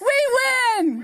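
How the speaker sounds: shouted call for the crowd; rally speech. Real, not acted. Leads crowd call and response.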